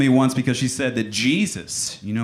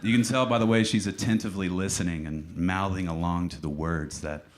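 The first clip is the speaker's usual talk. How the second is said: mock emotional; 3 semitones lower, 4 dB quieter than usual. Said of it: "I always get emotional at this part".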